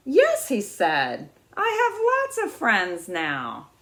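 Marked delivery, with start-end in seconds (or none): 0.1-0.5 sudden high, loud yes; surprised. Acted. Little beaver realising he has friends, high and surprised. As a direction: burst out in glad surprise. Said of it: "Little Beaver looked surprised".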